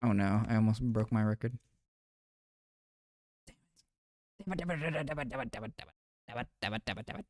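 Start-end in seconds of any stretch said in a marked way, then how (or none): 3.5-7.2 soft, repeated frustrated muttering under the breath. Real, not acted. Streamer nearly losing a record, quietly frustrated muttering. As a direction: grumble it quietly to yourself, again and again.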